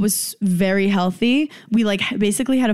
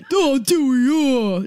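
Dan impression; 4 semitones higher, slower than usual. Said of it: "That was my Dan impression".

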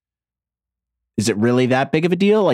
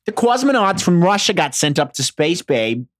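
hammed-up Jersey accent; thick, showy New Jersey accent; 5 semitones higher than usual. Shown as a demonstration. Comic mocking own Jersey speech, Jersey accent. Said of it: "I'm from Jersey".

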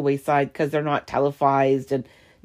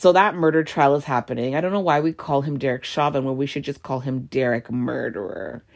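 ordinary delivery

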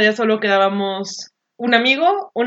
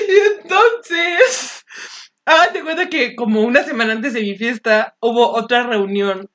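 ordinary delivery

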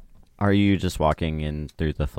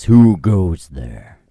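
slow, loud booming bellow; 11 dB louder, much slower than usual. Acted. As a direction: boom it out slowly like a giant. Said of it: "you hear a booming voice".

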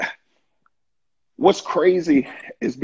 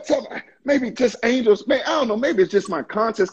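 ordinary talk, nothing marked

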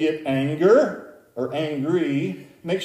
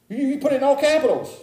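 high, quick, stammered accusation; accusing caller; 10 semitones higher, faster than usual. Retold or imitated. Caller explaining the capitals email, mock accusing. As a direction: imitate an indignant caller explaining the offence.

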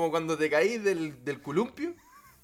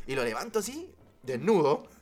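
usual speaking voice

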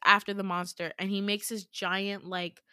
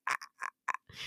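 choked gasps; 8 dB quieter, much faster than usual. Retold or imitated. Slammed against walls while choked.

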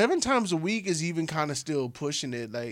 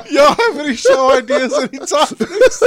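laughing; 9 semitones higher, 12 dB louder than usual. Real, not acted. Host bursts out laughing.

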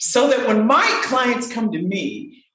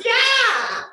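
much higher eager yes; the voice answering. Pretend. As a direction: answer like an excited little friend. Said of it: "And she says".

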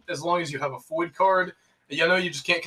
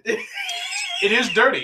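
laughing; 4 semitones higher, much slower than usual. Real, not acted. Laughing shout at dirty cup.

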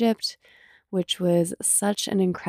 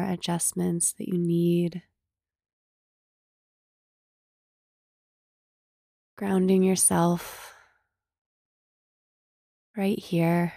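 slow meditation voice with long pauses; guided meditation; slower than usual. Acted. Host leading listeners to scan body and breath, guided meditation. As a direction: gently guide listeners into stillness, unhurried.

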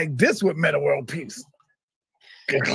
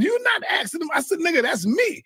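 higher, indignant retort; retold angry outburst; 8 semitones higher than usual. Friend refusing to invest, an indignant retold retort. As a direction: snap back at him, offended. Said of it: "I was mad as hell".

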